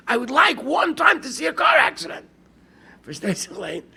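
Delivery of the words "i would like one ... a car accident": put-on higher, louder pleading yell of a little boy; child's excited shout. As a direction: belt it out like an eager cheder kid. Child begging bus driver, retold.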